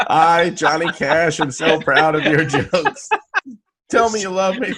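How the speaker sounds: mock the idol's voice, pleading. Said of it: "Can you just say".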